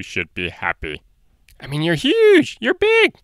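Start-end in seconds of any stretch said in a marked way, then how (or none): 1.6-3.2 high, loud delight; excited. Real, not acted. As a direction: gush over how huge he is. Enthusing about huge dinosaur.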